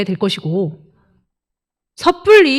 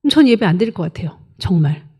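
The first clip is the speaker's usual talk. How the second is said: ordinary talk, nothing marked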